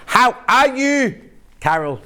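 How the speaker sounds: put-on lightly done Northern Irish accent, slow and drawn out. Presenter greeting Carol from Northern Ireland in a light Northern Irish accent. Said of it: "That's my Northern Irish accent".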